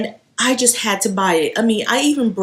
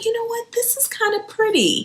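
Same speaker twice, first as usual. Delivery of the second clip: higher, quieter, won over; softened inner voice; 14 semitones higher, 4 dB quieter than usual. Retold or imitated. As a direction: voice the thought that won you over. Retold change of mind.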